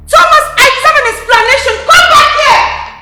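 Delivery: loud, high, demanding; calling out angrily. Acted. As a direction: shout after him as he leaves. She calls after Thomas leaving.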